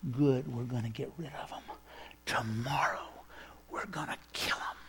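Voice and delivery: menacing whisper. Retold or imitated. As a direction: hiss the threat under your breath. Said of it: "the city is rising up going".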